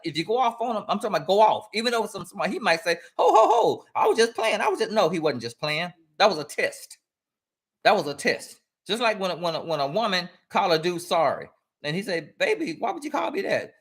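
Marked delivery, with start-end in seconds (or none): 3.2-4.9 high-pitched protest of innocence; mock backpedaling. Man challenged for name-calling claims he was playing, mock protest. 12.4-13.7 high-pitched, wounded pleading; mock hurt protest. Man called sorry questions his woman, mock hurt protest.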